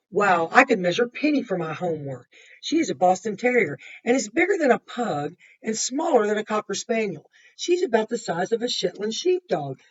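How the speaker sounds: lively picture-book narration; reading to children. A narrator's reading. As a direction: read it to the class with energy. Reads Lisa's story aloud.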